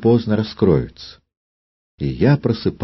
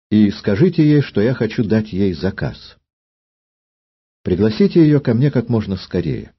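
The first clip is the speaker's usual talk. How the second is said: commanding queen; 4 semitones higher than usual. Acted. Queen gives an order.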